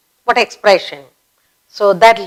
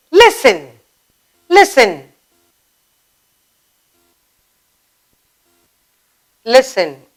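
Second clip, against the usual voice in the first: insistent repetition; urging listen. Shown as a demonstration. Teacher calling for attention. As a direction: press them to pay attention. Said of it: "Or you might say".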